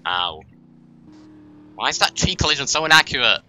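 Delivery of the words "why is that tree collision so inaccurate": exasperated, louder complaint; frustrated ranting at game physics; annoyed rant. Real, not acted. Angry at tree collision.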